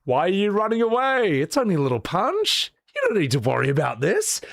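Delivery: put-on teasing old man. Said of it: "And the old man's like".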